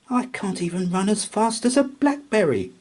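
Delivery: high, frustrated little mouse; huffy mouse. A narrator's reading. Mouse huffs to herself after the chase, high and frustrated. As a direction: huff it, defeated. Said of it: "she huffed".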